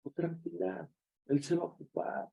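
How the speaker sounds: calm her down gently; reassuring. Retold or imitated. Pastor comforting the sister, soft and reassuring.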